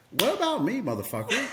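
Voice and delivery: high, joking outrage; mock-offended friend. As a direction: play the friend's fake hurt. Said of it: "And he goes".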